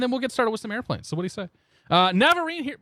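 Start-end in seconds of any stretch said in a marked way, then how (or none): 1.9-2.8 a burst of excited, louder and higher calling out; excited announcing. Real, not acted. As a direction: belt the name out excitedly, enough to pop the mic. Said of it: "I got really excited and popped".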